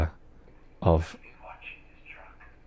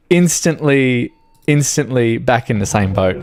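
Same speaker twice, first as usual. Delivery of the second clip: ordinary talk, nothing marked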